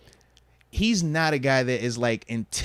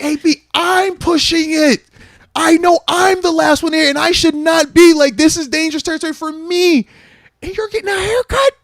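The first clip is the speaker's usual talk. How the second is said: exasperated yell; 7 dB louder than usual. Retold or imitated. Teammate yelling at A B for starting a haircut before the flight, exasperated shout. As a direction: yell at him, stunned by what he sees.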